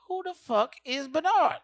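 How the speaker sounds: slow, high, loud disbelief; baffled retort. Retold or imitated. Comic at the door, slow loud baffled. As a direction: drag it out, baffled.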